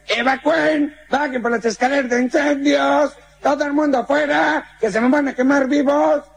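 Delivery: high, urgent yelling; shouting firemen. Acted. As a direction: bellow evacuation orders up the building. Firemen shouting evacuation orders.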